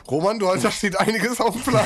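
teasing him through laughter; laughing. Real, not acted. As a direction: giggle it out at the newcomer. Laughing tease at newcomer.

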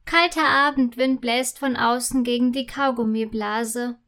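plain reading voice

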